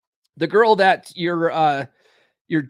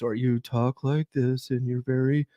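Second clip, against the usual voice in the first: dull, dragging donkey-like drone; Eeyore; 5 semitones lower than usual. Shown as a demonstration. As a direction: drone along glumly with no inflection. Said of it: "you're very boring and you sound like Eeyore".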